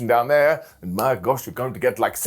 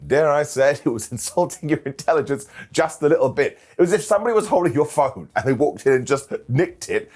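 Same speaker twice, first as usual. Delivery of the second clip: ordinary delivery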